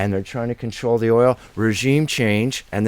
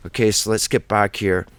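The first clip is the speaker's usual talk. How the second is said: normal talking voice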